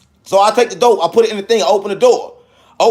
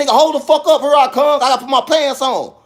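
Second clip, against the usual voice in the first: higher-pitched call through the door; brusque casual holler; 5 semitones higher than usual. Retold or imitated. Man calling to knocking customers he takes for regulars, brusque holler. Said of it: "I'm talking to them like they the regular geeks".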